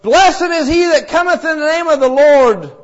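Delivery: high, loud praising shout; joyful crowd cry. Retold or imitated. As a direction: call it out like a cheering crowd. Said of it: "as loud as those cries of".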